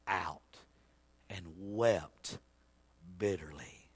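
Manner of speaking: low, soft, slow telling with long pauses; solemn preaching. Acted. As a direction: let it sink to a near whisper. Solemn telling of Peter's remorse.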